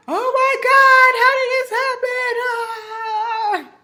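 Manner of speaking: high, loud panicked wail; freaking out. Retold or imitated. She sees the positive test and freaks out, high wailing. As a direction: shriek in shocked disbelief. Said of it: "So I start freaking out".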